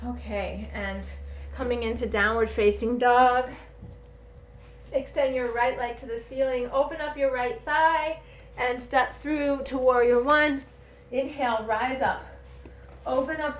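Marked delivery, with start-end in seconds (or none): none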